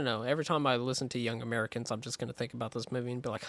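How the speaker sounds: ordinary talk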